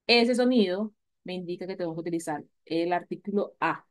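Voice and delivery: ordinary delivery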